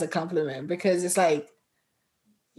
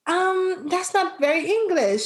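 higher-pitched mock correction; hesitant corrector; 9 semitones higher than usual. Imitating people judging her pronunciation, mock-hesitant. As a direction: imitate someone awkwardly objecting.

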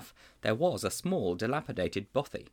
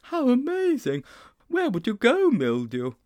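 much higher and louder, bursting with excitement; thrilled boy; 14 semitones higher, 6 dB louder than usual. A narrator's reading. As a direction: gush like a delighted child. Sponge thrilled by machine.

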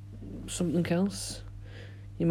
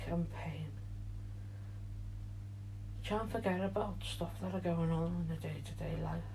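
soothing guided relaxation, fading quieter; guided meditation; 9 dB quieter than usual. Acted. Leads a meditation.

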